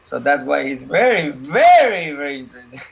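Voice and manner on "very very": second "very" much higher and louder; stressed repetition. Real, not acted. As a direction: lean hard on the second "very". Teasing emphasis on interest.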